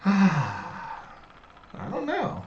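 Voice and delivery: stretched very slowly, pitched high and falling; drawn-out sing-song. Pretend. Echoing the co-host's shrug in a long sing-song. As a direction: draw out the last word like a lazy tune. Drawn-out echo after a laugh.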